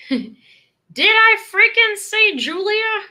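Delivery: high, loud, slow mock outrage; hammed-up indignation, drawn out; mock indignant. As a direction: act out being offended at the wrong name. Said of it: "And I'm like".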